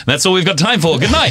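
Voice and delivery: high, bright announcer sign-off; mock host sign-off. Joking he'll end the interview, in a mock TV host sign-off. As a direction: close the show like a TV host.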